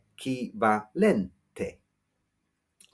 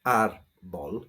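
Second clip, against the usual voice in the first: árbol stressed on its first syllable, AR-bol; slow teacher's model of árbol, front-stressed; 5 semitones lower than usual. Shown as a demonstration. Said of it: "the word is pronounced".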